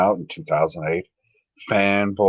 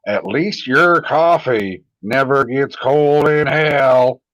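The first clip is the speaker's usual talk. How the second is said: slow, louder, drawn-out pronouncement; dramatic slow reading; 5 semitones higher, 5 dB louder, slower than usual. Shown as a demonstration. Reading the chat message aloud as a slow dramatic line. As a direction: read it like a grand dramatic line. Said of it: "I think that's how you're supposed to say it".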